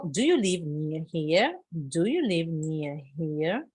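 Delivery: slow model question. Shown as a demonstration. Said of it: "teacher models question slowly".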